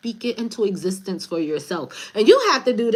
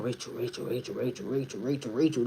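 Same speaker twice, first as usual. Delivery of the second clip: much lower and much softer, repeated chant; nagging spirit voice; 7 semitones lower, 9 dB quieter than usual. Retold or imitated. Imitating a spirit pestering her at bedtime, low and hushed. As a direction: drone the name over and over, low and hushed.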